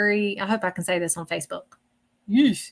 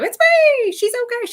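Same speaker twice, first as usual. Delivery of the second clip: much higher, louder cheerful call; excited calling out; 14 semitones higher, 6 dB louder than usual. Retold or imitated. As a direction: announce yourself brightly. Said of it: "let you know".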